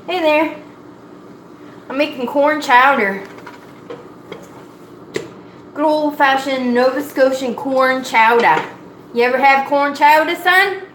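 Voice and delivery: loud cheery greeting. Acted. Greeting a newcomer loudly.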